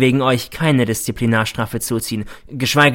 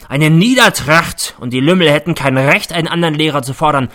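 loud, raging tirade; a principal performing fury at the boys; a loud theatrical rant; bellowing; 4 semitones higher, 5 dB louder than usual. A narrator's reading. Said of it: "brüllt er".

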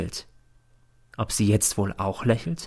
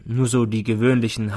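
ordinary delivery, nothing marked

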